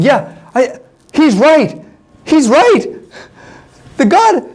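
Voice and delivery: high-pitched, agitated imitation of a crowd; alarmed crowd protest. Frightened people refusing to enter Canaan, acted alarm.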